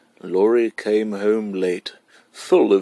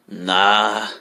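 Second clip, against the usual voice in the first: scornful. A narrator's reading. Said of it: "Laurie said scornfully".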